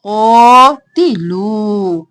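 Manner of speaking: slow, drawn-out tajweed chant; Quran recitation. Shown as a demonstration. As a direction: recite it slowly, stretching the vowels. Reciting verse for students.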